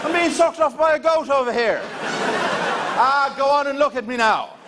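high, laughing pleading Irish lad; Irish accent. Retold or imitated. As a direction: beg for attention like a put-out Irish brother. Irish singer brother pleads.

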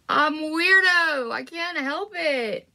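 high, stretched-out goofy cry; playful mock wail. Real, not acted. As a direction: draw it out high and silly, owning your weirdness. Joking apology for being gross.

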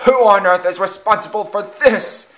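high, loud indignant demand. A narrator's reading. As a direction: demand to know the culprit. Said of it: "he demanded, still coughing".

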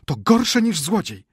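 high, loud, drawn-out outrage; indignant wife's shriek; Maria Michajłowna crying out. A narrator's reading. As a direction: cry out scandalised. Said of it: "krzyknęła Maria Michajłowna".

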